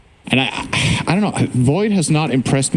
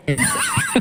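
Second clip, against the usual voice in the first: high laugh; 4 dB quieter than usual. Real, not acted. Laughing at the old man bit.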